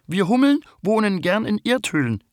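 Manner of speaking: very high bumblebee voice, a little sleepy; the queen bumblebee introduces herself; young queen bumblebee. Acted. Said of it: "erzählt Hanni". (Hummelkönigin mit sehr hoher Stimme, etwas verschlafen)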